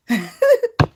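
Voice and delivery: high squeal of laughter. Real, not acted. Cracks herself up.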